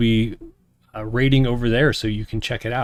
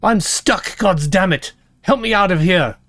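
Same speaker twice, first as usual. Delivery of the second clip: a stuck dwarf shouting for help; dwarf woman crying out to be pulled free; dwarf's cry for help; 6 semitones higher than usual. Acted. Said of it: "The cry goes up".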